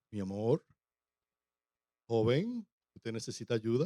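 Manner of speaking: low, smooth suitor voice; man's courting voice. Acted. Man wooing a lady at the well, courting voice. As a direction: drop the voice to win her over. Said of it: "cambia la voz".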